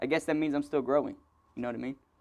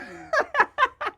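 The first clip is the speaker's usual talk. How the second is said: high laugh; 4 dB louder than usual. Real, not acted. Laughing at own story.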